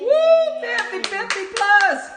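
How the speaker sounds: high, loud party whoop; excited cheer. Real, not acted. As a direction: cheer for the birthday guest. Cheering the birthday celebrant.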